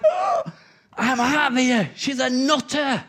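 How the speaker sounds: high, loud mock gasp into a hurried verdict; alarmed recoil. Preacher re-enacting his shock at seeing that woman, alarmed. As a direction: play up the horror, pitched high and loud.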